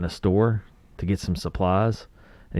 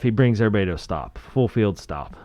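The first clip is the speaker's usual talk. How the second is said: normal talking voice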